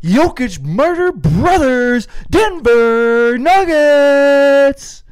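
high, slow, swooping arena call; hammed-up announcer with stretched vowels. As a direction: draw out the team name grandly. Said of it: "we do P A announcer voices".

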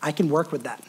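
low, soft, reassuring; understated, tender divine reply; gentle voice of God. Retold or imitated. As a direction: speak calmly as a patient father. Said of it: "God looks at that and says".

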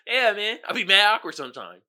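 ordinary talk

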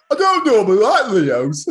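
hammed-up Brummie, high, loud and drawn out; slow, exaggerated Brummie mockery; mock Brummie accent. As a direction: mimic a broad Birmingham accent through laughter. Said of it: "one of the brummiest things".

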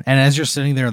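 ordinary delivery

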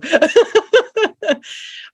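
bright high burst of real laughter; real laughter breaking out. Real, not acted. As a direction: laugh out loud, delighted. Host laughing at the story.

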